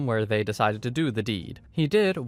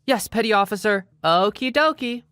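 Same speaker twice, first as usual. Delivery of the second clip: high, louder chirpy sass; cheeky singsong; 9 semitones higher, 4 dB louder than usual. Retold or imitated. Laundry girl sassing the petty officer, high chirpy singsong. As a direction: chirp it back teasingly.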